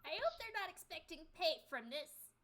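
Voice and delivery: a hint of a wary old innkeeper; man doing a woman's voice.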